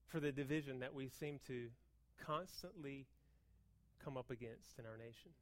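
soft, low, sincere delivery; earnest quiet preaching. Real, not acted. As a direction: drop down and mean it.

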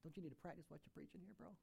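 soft, brotherly inner voice; Holy Spirit speaking. Retold or imitated. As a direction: hush it, quick and low, like a friend's aside. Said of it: "had the Holy Spirit".

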